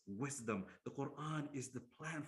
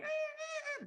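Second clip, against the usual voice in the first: very high mock newborn wail; imitated baby cry; slower than usual. Preacher imitating a newborn baby's wail. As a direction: squeal like a crying newborn. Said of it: "we start crying".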